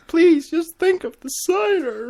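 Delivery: high, loud pleading cry; begging plea. Retold or imitated. Quoting Applejack begging to save the cider, high pleading cry. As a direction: beg desperately for the cider. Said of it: "Therefore the quote".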